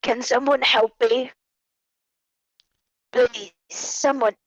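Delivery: loud, high plea for help; coarse-voiced dwarf. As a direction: call out desperately as a wounded dwarf. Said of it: "this coarse voice speaks".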